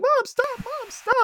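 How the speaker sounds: very high mock plea; pleading daughter. Acted daughter pleading with mom.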